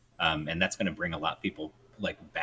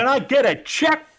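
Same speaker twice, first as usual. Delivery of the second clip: higher, louder hammed-up call; loud mock mic check; 13 dB louder than usual. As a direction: yell it like a soundman.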